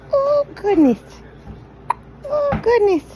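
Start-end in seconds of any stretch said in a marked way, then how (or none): none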